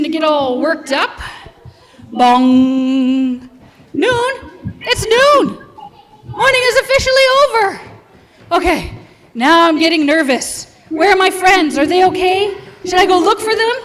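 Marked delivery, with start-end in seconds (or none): 2.1-3.5 loud mock clock chime; clock bong. Imitating a clock striking. 3.9-13.8 high, loud rising panic; panicking. Acted. Shell panics at noon.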